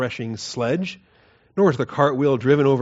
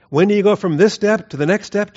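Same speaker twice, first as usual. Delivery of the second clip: higher, louder urgent preaching push; doomsday street preacher; 6 semitones higher, 6 dB louder than usual. Acted. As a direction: hammer the question home.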